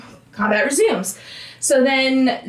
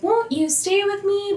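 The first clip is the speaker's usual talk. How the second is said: mock-creepy, high-pitched coaxing; 8 semitones higher than usual. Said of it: "a creepy way".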